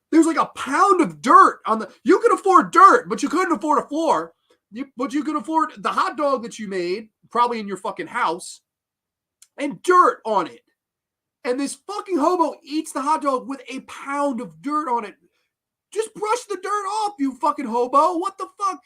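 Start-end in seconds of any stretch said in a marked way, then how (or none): 0.1-4.3 loud, high, incredulous; incredulous rant. Real, not acted. Reviewer ranting at the cheap film's dirt. 15.9-18.8 high, exasperated shout at the screen; exasperated yell. Real, not acted. Ranting reviewer yelling at a hobo on screen.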